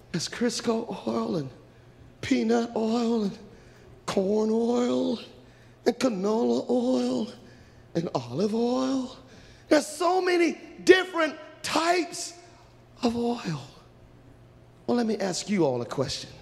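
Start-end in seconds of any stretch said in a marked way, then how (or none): 0.1-9.2 slow, higher-pitched item by item; acted wonder at the choices; overwhelmed listing. Girls dazed listing the many oils. 9.7-13.6 high, slow exclamation; acted amazement; overwhelmed. Girls overwhelmed by the choices.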